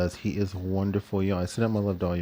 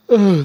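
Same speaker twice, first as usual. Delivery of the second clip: a big audible yawn, much higher and louder; real yawn; 11 semitones higher, 12 dB louder than usual. Real, not acted. At five a.m. on her live stream, a real yawn. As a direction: let a genuine yawn escape mid-sentence. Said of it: "that was a real yawn".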